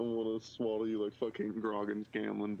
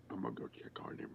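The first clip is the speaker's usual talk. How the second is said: whispering; 2 semitones lower, 9 dB quieter, faster than usual. Acted. Whispered aside.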